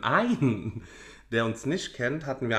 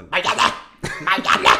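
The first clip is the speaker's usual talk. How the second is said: loud, high annoyed shout of a name; irritated call; 7 semitones higher, 8 dB louder than usual. Retold or imitated. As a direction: yell for Marianne, peeved. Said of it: "kommt er immer so".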